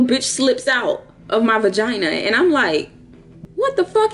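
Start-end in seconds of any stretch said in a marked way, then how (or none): 3.5-4.1 shocked outburst. Retold or imitated. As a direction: squeal it in mock shock. Shocked quote of herself mid-encounter.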